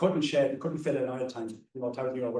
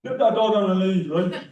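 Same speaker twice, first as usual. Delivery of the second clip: put-on high, loud inner-city Dublin kid scolding a dog walker; Dublin kids' accent; 6 semitones higher, 6 dB louder than usual. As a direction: bark it like a cheeky Dublin schoolkid. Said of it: "I shouldn't do the accent, but I will".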